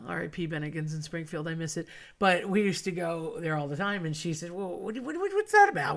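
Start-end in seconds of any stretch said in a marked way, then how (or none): 4.5-6.0 incredulous friend. Retold or imitated. Friend baffled by salt superstition.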